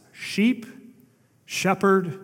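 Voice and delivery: normal talking voice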